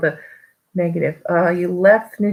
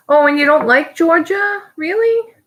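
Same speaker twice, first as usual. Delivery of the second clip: surprised; 11 semitones higher, 4 dB louder than usual. Real, not acted. Hearing the viewer dislikes Georgia.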